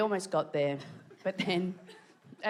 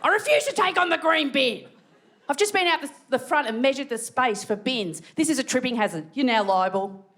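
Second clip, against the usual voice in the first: outraged ranter; 5 semitones higher than usual. Retold or imitated. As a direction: rant like an indignant local. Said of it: "People are losing their minds".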